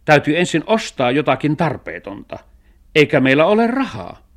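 high, loud, exasperated objection; annoyed cat. A narrator's reading. Cat points out they have no money, annoyed. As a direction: argue back crossly as the cat. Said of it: "suuttui kissa".

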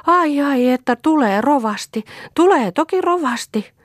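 high, loud, frantic cry; wild exclaiming. Acted. Mother frantically crying out as she runs. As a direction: burst out like a woman gone wild.

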